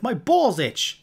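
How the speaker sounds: slow, loud, high New York grouch; Grumble. Retold or imitated. Grumble answering his admirers, slow New York grouch. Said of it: "He has this New York accent".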